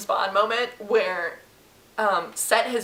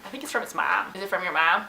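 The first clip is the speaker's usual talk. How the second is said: ordinary talk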